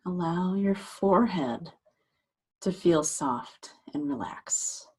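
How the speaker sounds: unhurried meditation guide, long pauses between phrases; guided meditation. Acted. Meditation teacher guiding listeners to relax shoulders and jaw, guided meditation voice. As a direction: gently talk them into settling, leaving space after each phrase.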